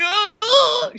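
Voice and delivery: high, breathy fake moans; mock moaning. Describing the cartoon's ending, high mock moans. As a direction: imitate the cartoon's moaning noises. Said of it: "turns into jerk-off noises".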